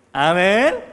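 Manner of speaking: high, shouted amen; loud amen. Real, not acted. Preacher calling the congregation to answer, shouted amen. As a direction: call out amen to rouse the congregation.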